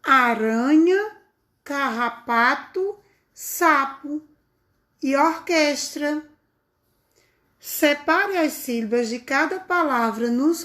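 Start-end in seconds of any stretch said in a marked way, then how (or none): 0.0-6.3 "Aranha", "carrapato", "sapo", "orquestra" read slowly and clearly, syllable by syllable; read aloud slowly. Shown as a demonstration. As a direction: model each word slowly for the children to repeat. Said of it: "Fale as palavras a seguir em voz alta".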